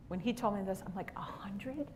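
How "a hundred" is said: shocked whisper. Retold or imitated. Mom stunned at bet.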